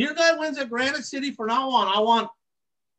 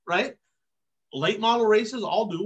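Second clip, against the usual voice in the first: ordinary talk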